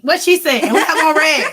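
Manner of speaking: laughing through the words; loud and giggly. Real, not acted. Joking that they both wear red.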